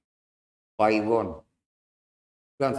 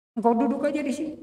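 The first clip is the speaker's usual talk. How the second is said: much higher and fast, a lightly voiced master; kindly master; 4 dB louder, much faster than usual. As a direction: urge him quickly to sit. Master invites servant to sit.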